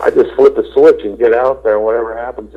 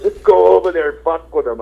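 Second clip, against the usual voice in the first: higher, put-on voice egging him on; imitating the photographer; 7 semitones higher than usual. The photographer urging him toward the band, higher mock voice. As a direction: play the pushy photographer goading him. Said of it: "The photographer goes".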